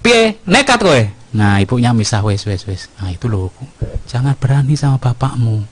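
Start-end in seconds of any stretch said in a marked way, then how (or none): 0.0-1.0 high, sharp mock scolding; scary father. Father playing the frightening parent to his child, mock-threatening. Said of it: "Aku sing medeni". 2.2-5.6 low, soothing hush; gentle mother. Pretend. Mother separating father and child, gently soothing. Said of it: "ibunya yang lembut-lembut".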